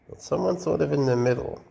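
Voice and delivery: ordinary talk, nothing marked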